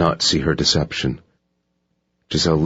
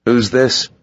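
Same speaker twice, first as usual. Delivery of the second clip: sharp, higher man's question; alert guard; 7 semitones higher, 4 dB louder than usual. A narrator's reading. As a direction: snap the question out.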